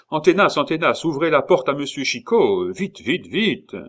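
loud, high urgent command; shouted order. A narrator's reading. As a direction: shout to a subordinate to hurry. Officier crie l'ordre d'ouvrir la porte. (ordre crié, pressant)

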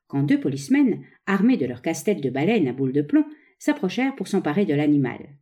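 usual speaking voice

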